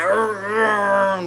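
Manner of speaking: mock slimy troll grunt. Imitating the slimy Ernest Scared Stupid troll with gross grunts. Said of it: "slime dripping out like".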